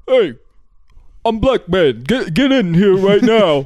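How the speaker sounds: high, loud bossy police chief; mock angry chief yelling; Blackman voice. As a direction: bark orders like a cartoon police chief. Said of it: "Blackman's voice is like".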